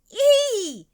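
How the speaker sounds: very high, loud shriek; short piercing storybook squeal; ghost's yell. A narrator's reading. The ghost yells back at Katie, very high shriek. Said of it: "yelled the ghost".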